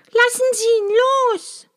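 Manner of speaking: a desperate high plea; calling out. A narrator's reading. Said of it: "rief Maja".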